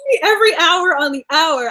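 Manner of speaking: mock-bossy, excited invitation; emphatic insistence. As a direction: stretch it out, eagerly insisting. Acted emphatic reply to apologetic friend.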